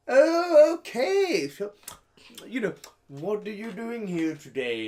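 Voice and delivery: very high, loud, hammed-up lady; mock fake wife. S0 mimics the fake wife's chirpy questions, very high. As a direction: squeal it like an overeager actress. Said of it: "She's like".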